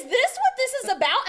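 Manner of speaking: high, loud furious demand; angry yell. Retold or imitated. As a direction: confront him at full volume, outraged. Angry confrontation on FaceTime.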